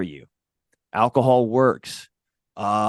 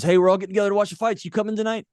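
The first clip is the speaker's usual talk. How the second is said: much higher, fast eager invite; buddy's invite; 10 semitones higher, much faster than usual. Retold or imitated. Guest imitating a buddy inviting him to the fights, high and fast. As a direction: rattle it off like a keen friend. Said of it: "a buddy calls and says".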